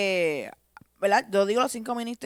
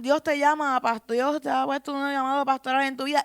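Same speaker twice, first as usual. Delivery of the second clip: higher, insistent retelling of what people told her; retold urging voices; 4 semitones higher, 3 dB louder than usual. People urging her to accept the pastoral call, higher and insistent. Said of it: "Ahí me decían".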